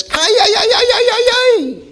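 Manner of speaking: high, loud yodelling shepherd call; shepherd's call. Retold or imitated. Shepherd calling his sheep across the field, high and loud. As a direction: call the flock with a high whooping cry. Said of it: "he lifts up his voice and says".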